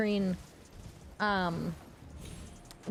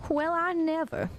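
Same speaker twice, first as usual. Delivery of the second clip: high Southern belle, affronted; Southern belle's scandalized exclamation; 7 semitones higher than usual. Acted. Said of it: "Can I do a Southern Belle voice?".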